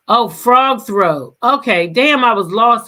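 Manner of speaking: loud, startled realization; loud amused outburst; exclaiming. Real, not acted. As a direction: shout it out as it finally clicks. Bursts out on getting the joke.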